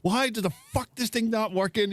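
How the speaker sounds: high-pitched imitation of someone fed up; mild mock exasperation. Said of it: "ready to, like, jump off a cliff".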